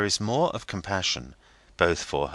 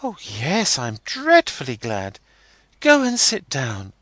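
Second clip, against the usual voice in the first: put-on young girl Rosa; 7 semitones higher, 5 dB louder than usual. A narrator's reading. Rosa voiced in story.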